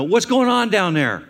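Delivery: loud, high call down the stairs; parent yelling downstairs. Retold or imitated. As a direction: shout down to the basement, half-mocking. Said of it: "you yell down".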